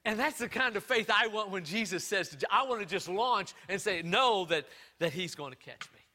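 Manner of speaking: loud, high, fervent preaching; full-force preaching. Acted. Pastor preaching to his church, loud and fervent.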